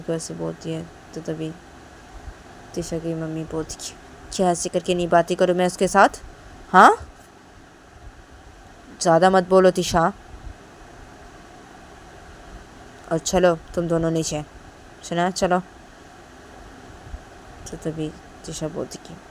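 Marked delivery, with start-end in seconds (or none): 4.3-15.6 louder stern telling-off; stern scolding. Acted. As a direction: cut her off, stern and loud. Mother scolds Tisha back.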